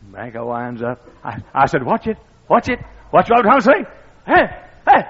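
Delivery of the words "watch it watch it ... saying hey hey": high, loud building anticipation; preacher telling his friend the pitch is coming; urgent excitement. Retold or imitated. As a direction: press it louder as the pitch comes. Urging his friend at the replay.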